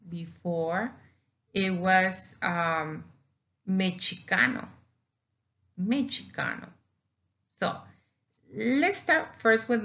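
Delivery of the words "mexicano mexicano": "mexicano" said as me-chee-KA-no; old CH pronunciation. Shown as a demonstration. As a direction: say "mexicano" with a ch sound for the x. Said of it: "And before that, it was pronounced mexicano".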